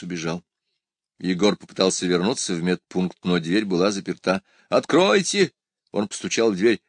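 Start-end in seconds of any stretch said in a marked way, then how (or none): 4.7-5.5 high-pitched urgent call. Acted. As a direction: call through a locked door. Егор стучит в запертую дверь. (высокий призывный окрик)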